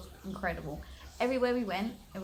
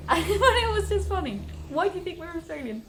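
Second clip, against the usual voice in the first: high laughing question, a bit fast; amused disbelief while laughing; 9 semitones higher, 7 dB louder, faster than usual. Real, not acted. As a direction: laugh through the line. Laughing retelling.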